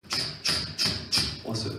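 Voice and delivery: whispered stomping sound of soldiers; marching sound effect. Pretend. Preacher miming soldiers marching in, whispered. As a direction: breathe out each 쭉 like marching feet. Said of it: "목사님은 이렇게 하는 게 웃기잖아요?".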